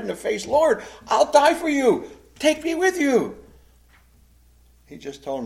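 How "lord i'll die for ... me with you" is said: high, loud, slow pleading vow; emphatic protest. Retold or imitated. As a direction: insist with everything, drawn out and raised. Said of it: "Peter's protesting emphatically".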